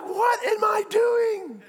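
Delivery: high, slow panicked shout; mock panic. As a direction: yell it like you're frozen at the open plane door. Panicked skydiver about to jump.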